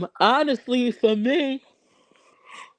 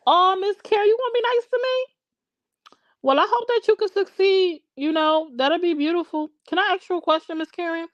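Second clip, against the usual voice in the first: much higher, syrupy fake-nice voice; mock sweetness; 11 semitones higher than usual. Host answering a commenter who vows to be nice, mock sweet. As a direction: kill her with sugary kindness.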